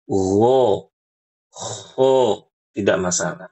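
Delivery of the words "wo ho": rounded pouty wo ho; the wrong, pouted way; wrong monyong vowel. Shown as a demonstration. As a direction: say wo ho with pushed-out lips. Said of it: "Jangan sampai kayak gini".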